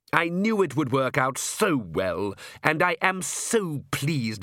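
smooth, self-satisfied aristocrat, delighted with himself; genial vampire count. Acted. Count reassuring the king about vampires, genially smug. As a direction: suave vampire charming his guests.

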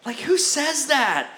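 high, slow incredulous question; comic incredulity; mock disbelief.

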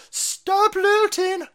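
high, drawn-out mock wail; hammed-up high-pitched pleading; mock plea. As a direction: stretch it out high in a put-on plea. Acts out grandmother's plea to rioters.

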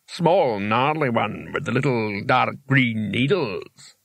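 slow, drawn-out creature voice; talking rock. Acted. Rock directs Rincewind to onions, slow otherworldly voice. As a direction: stretch the words out as a helpful stone would. Rock's voice describes the yew.

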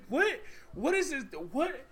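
high-pitched incredulous questions; baffled disbelief. Retold or imitated. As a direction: squeak out each bewildered question. Retelling own baffled reaction.

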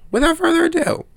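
squeaky high voice on "without further ado"; high squeak. Shown as a demonstration. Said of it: "repeated intro phrase, squeaked high".

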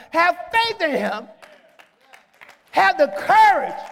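impassioned, sustained proclamation; full-force preaching. Real, not acted. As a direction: drive each phrase home to the congregation.

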